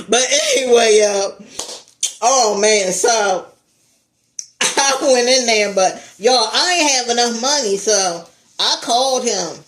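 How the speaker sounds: ordinary talk, nothing marked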